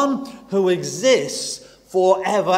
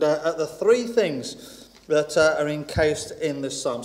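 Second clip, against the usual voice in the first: usual speaking voice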